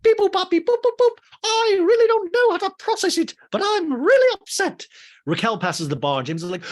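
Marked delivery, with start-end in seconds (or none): 0.0-1.2 high, loud beep-boop robot noises. Retold or imitated. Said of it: "that Dorit C three P O". 1.4-4.9 much higher, louder stiff robot voice; mock-robotic droid delivery; C-3PO robot voice. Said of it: "that Dorit C three P O".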